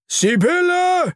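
very high, drawn-out call; loud call. Acted. Earthworm calls the spider out, calling loudly. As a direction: take a breath and call out. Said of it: "ruft ganz laut".

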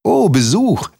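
high, swooping exclamation of welcome; delighted call. A narrator's reading. Mole greets the unexpected visitor, calling out delightedly. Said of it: "rief der Maulwurf".

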